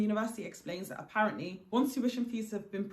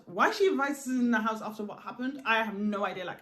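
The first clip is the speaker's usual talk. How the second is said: ordinary delivery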